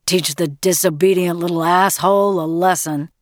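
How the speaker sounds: gruff, harsh father's voice; growling. A narrator's reading. Father dismissing mother's fears for their son, growling. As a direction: growl it with contempt. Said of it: "growled Red".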